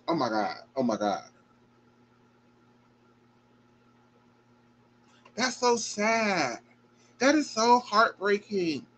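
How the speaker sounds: soft, slow, quiet grief; overwhelmed and quietly sad; heartbroken. Real, not acted. Host heartbroken over Gabby's story, soft. Host reacting, deeply moved.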